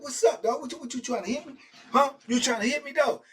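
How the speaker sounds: high, loud taunting shout; retold taunt. Taunting cellmate after grabbing the knife, shouting. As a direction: get in his face and shout. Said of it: "I'm like".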